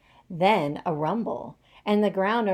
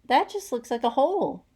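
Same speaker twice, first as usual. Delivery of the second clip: high, louder, chirpy chick voices; cheeping chicks; 6 semitones higher, 5 dB louder than usual. A narrator's reading. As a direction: peep it like a worried little chick. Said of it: "cheeped the chicks".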